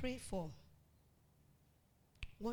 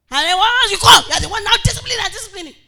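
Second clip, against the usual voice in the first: preaching shout; 11 semitones higher, 24 dB louder, faster than usual. Acted. Preacher mimics scolding parent.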